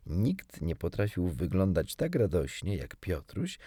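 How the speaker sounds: usual speaking voice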